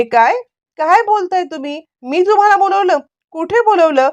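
can't believe what she's hearing; shocked disbelief. A narrator's reading. Wife stunned by husband's claim, high disbelieving questions.

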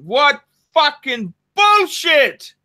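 slow, high, disgusted outburst; angry. Real, not acted. Host rejecting that statement, angry and emphatic. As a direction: spit out each word in indignation. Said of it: "That really makes me angry".

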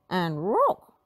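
dog-speech cry; Scooby-Doo voice. A narrator's reading. Scooby showing the prints in dog voice. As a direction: point out the prints. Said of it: "cried Scooby".

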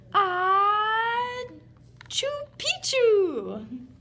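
loud high sneeze through the words; half sneeze it out; sneezed password. A narrator's reading. Skipito sneezing the password into the ear. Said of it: "half sneezed, half spoke".

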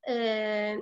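ordinary talk

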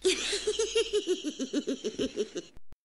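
long high witch's cackle; high cackling laughter running down. Acted. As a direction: cackle away, high and rapid. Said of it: "la sorcière riait tellement".

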